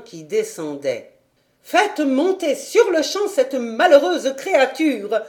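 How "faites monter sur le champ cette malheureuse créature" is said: higher, louder commanding cry; shouted order. A narrator's reading. Governess ordering the butler to send the girl up, shouting. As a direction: bark the order at the servant. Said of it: "lui cria-t-elle".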